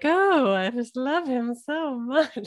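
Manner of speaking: high, drawn-out swooning; mock lovesick Lisa. Mocking Lisa losing her head, in a high swoon. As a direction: gush slowly in a hammed-up lovestruck voice. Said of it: "bring her down".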